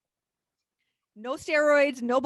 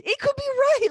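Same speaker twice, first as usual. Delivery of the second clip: much higher and louder, bursting with excitement; excited outburst; 13 semitones higher, 6 dB louder than usual. Real, not acted. Cohost getting overexcited in a sports debate, excited shout. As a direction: blurt it out, too thrilled to hold back. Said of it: "oh my God, calm down".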